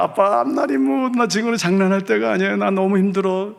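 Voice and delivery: put-on high, strained girl's voice; upset daughter. Daughter upset, refusing to joke.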